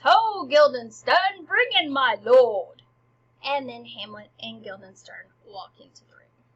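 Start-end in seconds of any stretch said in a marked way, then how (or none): 0.0-2.8 loud, drawn-out stage summons; theatrical herald's call; calling out as Rosencrantz. Acted. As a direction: holler for someone offstage, stretching the words. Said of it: "And Rosencrantz".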